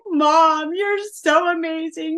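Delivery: put-on very high, drawn-out tearful child's voice; imitated happy bawling. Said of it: "she's just bawling".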